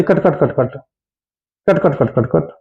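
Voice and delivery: soft repeated कट, कट, कट bell sound; imitated bell. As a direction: tap out the bell softly, कट कट कट. (धीरे-धीरे घंटी की कट कट आवाज़)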